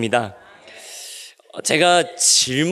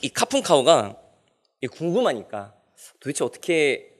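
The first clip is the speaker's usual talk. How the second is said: usual speaking voice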